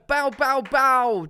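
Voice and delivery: mock cheering noise; high playful chant; silly chant.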